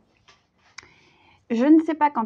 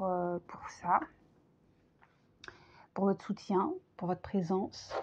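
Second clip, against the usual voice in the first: tender whisper; 4 semitones lower, 11 dB quieter than usual. Real, not acted. Heartfelt thanks to viewers.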